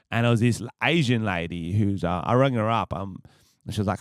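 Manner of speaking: normal talking voice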